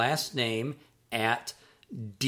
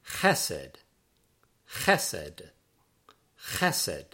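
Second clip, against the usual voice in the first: "chesed" as KHEH-sed, dropping low after the stress; stress on first syllable; 6 semitones lower, much slower than usual. Shown as a demonstration. Teacher models the word for love or loyalty, "chesed" stressed on the first syllable. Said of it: "the accent on that word is on the first syllable".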